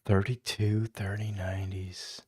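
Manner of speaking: unvoiced whisper, barely audible. Real, not acted. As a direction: breathe the numbers under your breath. Reading numbers to himself.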